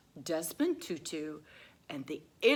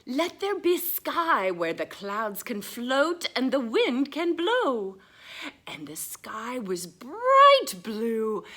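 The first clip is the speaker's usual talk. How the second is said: loud, higher, slow proclaiming; grand proclamation; 8 semitones higher, 10 dB louder than usual. Acted. Reading God's words to children, loud and slow. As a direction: ring it out like a command.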